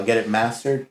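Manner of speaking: normal talking voice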